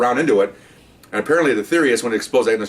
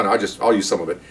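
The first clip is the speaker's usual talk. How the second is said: normal talking voice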